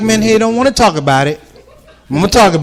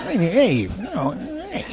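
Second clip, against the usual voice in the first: much softer broken hey-hey; mumbling old man; 3 semitones higher, 11 dB quieter, slower than usual. Retold or imitated. Father answering daughter about the park, soft broken heys. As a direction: trail off like a tired old dad. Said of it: "But it came out".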